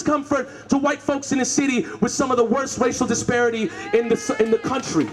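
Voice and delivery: fierce speech read aloud; protest speech. Acted. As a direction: call out the official by name. Reads protest speech to official.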